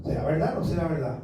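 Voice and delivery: low, quick mutter to oneself; doubting mutter. Retold or imitated. Believer doubting God's word to himself, low quick mutter. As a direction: mumble the doubt under your breath, hurried.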